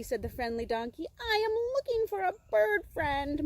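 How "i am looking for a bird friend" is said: high, plaintive little-bird voice; sad moan. Acted. Little bird confiding in the donkey, high sad moan. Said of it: "moaned the little bird quite sadly".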